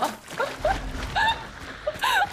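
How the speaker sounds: high, breathy burst of laughter. Real, not acted. Laughing at a friend's maddening replies, high burst of laughter. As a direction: laugh it off in disbelief.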